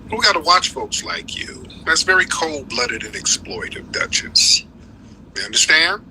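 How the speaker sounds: ordinary talk, nothing marked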